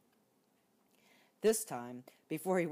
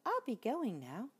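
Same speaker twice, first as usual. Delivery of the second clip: higher mouse voice, mildly; lightly voiced mouse leaving; polite mouse; 8 semitones higher than usual. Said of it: "said the mouse".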